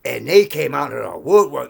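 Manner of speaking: normal talking voice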